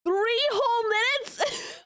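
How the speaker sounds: slow, high, whining mock cry; fake-sob over three minutes; mock wail. To Cap, wailing in mock grief over three minutes. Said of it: "And I'm like".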